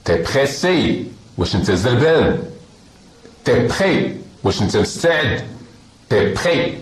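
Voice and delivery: ordinary talk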